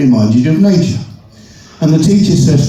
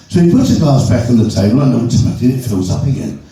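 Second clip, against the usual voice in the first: ordinary talk, nothing marked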